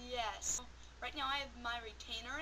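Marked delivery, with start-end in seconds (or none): none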